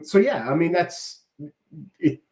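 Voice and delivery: normal talking voice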